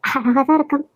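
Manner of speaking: normal talking voice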